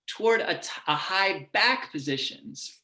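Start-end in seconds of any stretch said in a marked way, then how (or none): none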